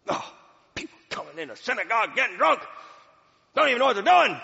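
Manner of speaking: high, loud indignant scoff; a judgmental old priest sneering; scornful priest. Retold or imitated. Eli judging the weeping woman, loud scornful scoff.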